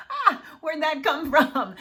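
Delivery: laughing at herself, surprised; amused at what just came out; laughing surprise. Real, not acted. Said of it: "hear your mom come out of your mouth".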